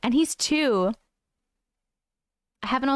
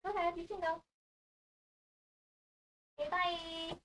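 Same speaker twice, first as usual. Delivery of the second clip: higher, much softer coaxing voice; soft pet talk; 6 semitones higher, 13 dB quieter than usual. Real, not acted. Letting her cat go off camera, soft high coaxing. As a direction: gently coax the cat off, softly.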